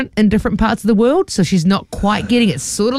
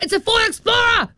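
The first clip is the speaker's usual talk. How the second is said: insistent; 3 dB quieter than usual. Retold or imitated. Friend insisting to trolley guy.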